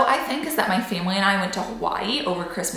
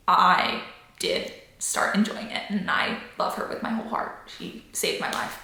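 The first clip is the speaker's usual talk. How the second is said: heartfelt whisper; 6 dB quieter than usual. Real, not acted. Heartfelt thanks to her dietitian.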